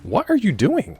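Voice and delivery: high, loud cry of disbelief; sudden high exasperated shout; exasperated yell. Real, not acted. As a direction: yell it at the screen. Yelling at game.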